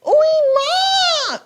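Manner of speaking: very high squeaky mock call; a high, hammed-up imitation of Salman Khan; Salman Khan impression. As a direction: shriek it like a film hero's catcall. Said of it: "Salman Khan going".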